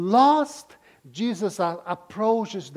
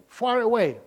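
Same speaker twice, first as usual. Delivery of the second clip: ordinary talk, nothing marked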